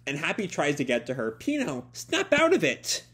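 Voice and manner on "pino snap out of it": high-pitched pleading cry; acted high, urgent appeal; urgent plea. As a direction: shoot the voice up, desperate to get through. Happy tries to reach hypnotized friend.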